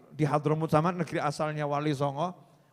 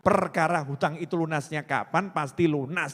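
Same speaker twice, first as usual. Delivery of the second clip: usual speaking voice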